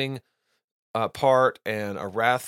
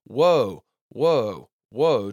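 louder, called-out Woe, woe; eagle's loud cry; 7 dB louder, much slower than usual. Retold or imitated. Eagle crying woe over the earth, loud. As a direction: cry Woe out like a warning from overhead. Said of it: "crying with a loud voice".

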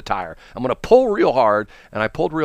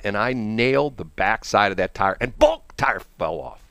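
normal talking voice

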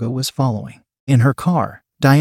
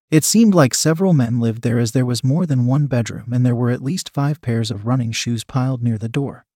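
ordinary delivery, nothing marked

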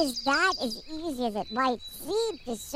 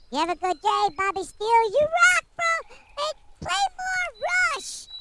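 excited squirrel sign-off; 13 semitones higher than usual. Pretend. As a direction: cheer it out like a squirrel fan.

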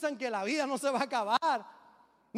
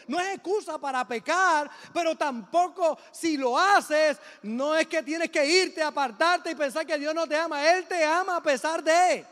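louder, pressing preacher's voice; preaching; 3 semitones higher, 5 dB louder than usual. Acted. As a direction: urge the church not to give up. Preaching at full force.

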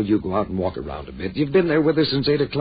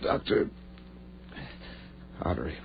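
faint, broken words; dying gasps; 2 semitones lower, 7 dB quieter than usual. Acted. Dying Ed gasps to David, faint broken words. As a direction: fade out with each breath.

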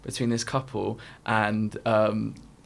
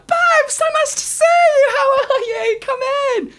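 very high, loud squealed welcome; fake delighted greeting; 8 dB louder than usual. Pretending to know him after a bribe, fake delighted squeal. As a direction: shriek a gushing hello, hammed up. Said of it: "to pretend that we know him".

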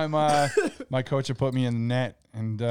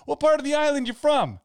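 high loud mock question; skeptical questioner; 13 semitones higher, 4 dB louder than usual. Comic questions the Boston guy, high loud mock. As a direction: quiz him, pitched way up. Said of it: "it's like".

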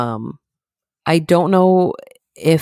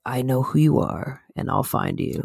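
low, soft menacing phone threat; deep-voiced brother on the phone, hushed; little brother's deep voice; 3 semitones lower, 7 dB quieter than usual. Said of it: "with his deep-ass voice".

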